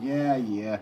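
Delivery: mock Boston accent. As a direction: imitate Bostonians, loud and drawn out. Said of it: "They're like".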